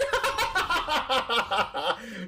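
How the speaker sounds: long helpless cackle, falling in pitch; laugh. Real, not acted. Streamer cracks up at the misplay, helpless laughing.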